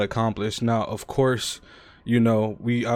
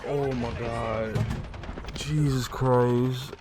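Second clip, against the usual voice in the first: raging gamer; 3 semitones higher, much slower than usual. Real, not acted. Drawn-out exhausted relief.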